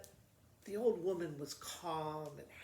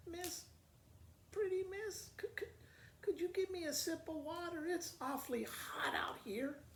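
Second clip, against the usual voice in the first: put-on old lady voice; 10 semitones higher than usual. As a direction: sound like a frail old woman begging for water. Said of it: "a little old lady tapped her ... and said".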